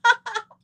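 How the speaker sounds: high, imitated "Ha, ha, ha"; mock dad laugh. Dad laughing after scaring his daughter, high mocking laugh.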